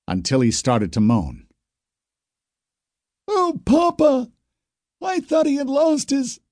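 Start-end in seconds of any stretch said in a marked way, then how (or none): 3.3-6.4 put-on high, slow, tearful girl's voice; sobbing. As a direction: sob it out over her father. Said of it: "Marissa sobbed".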